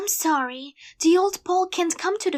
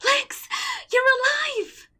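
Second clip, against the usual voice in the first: higher, louder cry of relief; joyful surprise; 12 semitones higher, 4 dB louder, slower than usual. Acted. Paul hearing that Lex survived, joyful surprise. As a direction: gasp it out in disbelief and joy. Finds presumed-dead friend alive.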